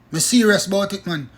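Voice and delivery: usual speaking voice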